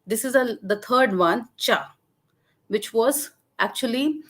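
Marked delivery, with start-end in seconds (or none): 1.6-2.0 short "cha" dropping low. Shown as a demonstration. Lecturer demonstrating the third Dogri word, "cha" in low tone. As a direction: let "cha" fall to a low pitch. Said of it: "a syllable bearing a low tone".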